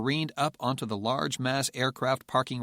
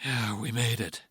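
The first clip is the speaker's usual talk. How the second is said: breathy relief. Acted. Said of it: "Patrick breathed".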